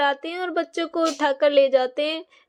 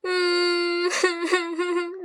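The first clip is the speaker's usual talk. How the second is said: villainous beggar woman's cackle; mock witch cackle; evil cackle. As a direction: laugh wickedly at the crying boy. Wicked beggar laughs at child.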